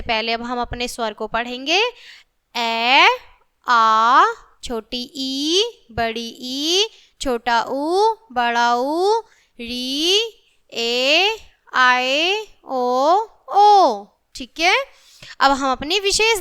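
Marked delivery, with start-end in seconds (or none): 2.6-14.1 put-on slow, sing-song letter by letter recitation for children; reciting the alphabet. Shown as a demonstration. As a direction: chant each vowel clearly for the class to repeat. Teacher recites the vowels aloud.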